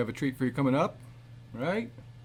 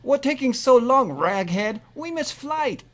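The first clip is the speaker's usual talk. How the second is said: much higher put-on cabbie voice; rude cab driver; 9 semitones higher than usual. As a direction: snap at the passenger.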